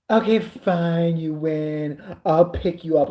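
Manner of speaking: slow, dragged-out mock surrender; grudging huge effort. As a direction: give in as if it costs enormous effort. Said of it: "it's the biggest effort on the planet".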